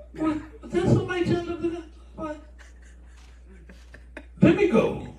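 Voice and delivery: high, hurried pretence of not noticing; mock snubbing. Shoppers pretending not to see a former church friend, mock snubbing. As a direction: play someone dodging an old acquaintance in a high voice. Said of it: "they act like they don't even see you".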